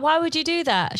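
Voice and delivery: mock stunned disbelief at the kindness; retold astonishment. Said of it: "And I was like".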